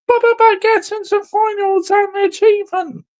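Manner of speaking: high, whiny mock voice of a defending fan. Ranting fan mimics rival fans' excuses, whiny mock voice. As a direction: sneer it like a whining apologist.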